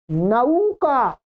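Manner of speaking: नौका drawn out slowly as nau-ka; नौका with the Sanskrit au diphthong; Sanskrit pronunciation. Shown as a demonstration. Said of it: "नौका बोलेंगे।".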